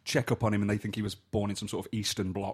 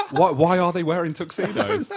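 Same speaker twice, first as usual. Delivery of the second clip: high, loud, laughing question; real laughing; 8 semitones higher, 8 dB louder than usual. Real, not acted. Asking friends about the absurd football scene, laughing incredulously. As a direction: ask it helpless with laughter.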